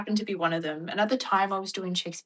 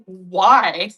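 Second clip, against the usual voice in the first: defiant why; 9 dB louder than usual. Retold or imitated. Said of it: "And I was like".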